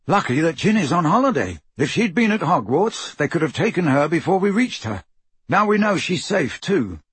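put-on lifted, lively young man's dialogue; Bill, urgent and relieved. A narrator's reading. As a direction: explain it urgently to the family. Voices Bill talking to the family.

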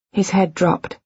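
plain reading voice

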